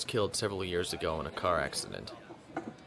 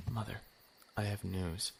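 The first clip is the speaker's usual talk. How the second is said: softer, held-back telling; hushed; 8 dB quieter than usual. Acted. Son retells his news to mother, now hushed. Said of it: "Shh, quiet, you'll wake Harriet".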